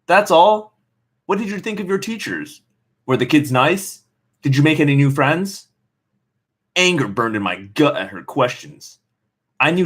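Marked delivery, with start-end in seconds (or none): 0.1-5.7 a lightly voiced mom pushing for more; mom calling after. Mom calling up after her daughter, pressing. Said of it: "Mom called after me".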